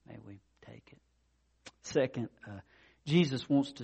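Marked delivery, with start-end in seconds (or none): 0.1-1.0 near-whispered, much softer; hushed. Real, not acted. Preacher urges congregation to take freedom, hushed. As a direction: let it drop to a hush. Hushed close after long pause.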